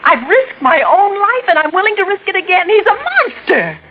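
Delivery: high-pitched terrified insistence; desperate pleading. Acted. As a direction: beg him to believe you before it's too late. Begging detective for protection.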